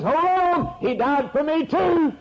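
a high, lightly voiced damned soul; a lightly voiced soul in hell objecting to the preacher; damned man protesting. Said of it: "Somebody from the regions of the damned says".

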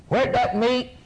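usual speaking voice

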